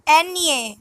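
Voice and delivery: N A said as "EN-yeh"; Spanish letter Ñ. Shown as a demonstration. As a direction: say N A with a ny sound, eñe. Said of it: "It is pronounced as".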